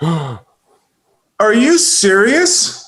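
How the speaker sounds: very high, drawn out, slow; stunned disbelief. Acted. Husband hearing of his wife's affair, high and slow shock. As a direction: let it hang in shock. Wife reveals her own affair.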